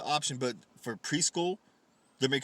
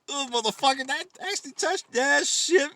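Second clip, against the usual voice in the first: mock disgust; 10 semitones higher, 4 dB louder than usual. Imagining Michelle's thought while wiping her hand, mock disgust. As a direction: imitate someone grossed out by a touch. Acts out disgust after a handshake.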